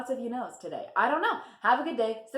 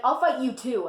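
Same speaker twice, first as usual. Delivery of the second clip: threatening; 4 semitones higher, 6 dB louder than usual. Retold or imitated. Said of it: "she's like".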